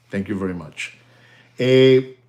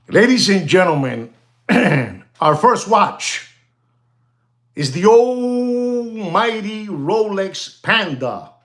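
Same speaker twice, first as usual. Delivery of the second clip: grand announcement; 6 semitones higher, slower than usual. Acted. Announces first watch grandly.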